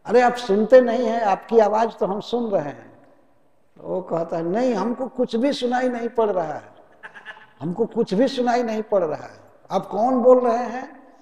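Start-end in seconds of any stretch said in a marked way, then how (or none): 0.1-3.1 higher and louder, calling over a bad line; shouting down the phone. 4.4-11.1 higher, loud fake-confused phone voice; mock shouting. Debtor faking a bad line.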